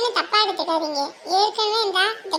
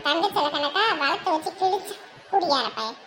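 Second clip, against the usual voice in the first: lower, soft sneering parody with a laugh; mocking imitation; 4 semitones lower, 3 dB quieter than usual. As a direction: throw her boast back with scorn. Mocks the other mother's boast. (கேலியாக நக்கலாக திருப்பிச் சொல்லுதல்)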